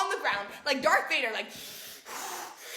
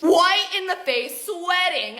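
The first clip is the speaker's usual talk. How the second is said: ordinary talk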